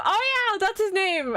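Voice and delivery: high, delighted realization; sudden recognition. Real, not acted. Streamer remembering the character's name for viewers, excited. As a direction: burst out high as it comes back.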